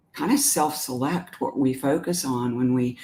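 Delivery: normal talking voice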